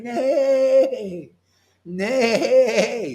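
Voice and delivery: high mock neigh of a horse. Preacher neighs like a horse to mock naysayers. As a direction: bray out the nay like a horse. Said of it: "naysayer is like a horse".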